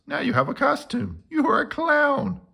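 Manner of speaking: high, delighted; gleeful and bright-eyed; excited. Acted. Boris delighted at clown.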